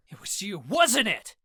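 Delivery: rising accusation, high and pushy; demanding. A narrator's reading. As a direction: confront him, demanding an answer. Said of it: "I demanded".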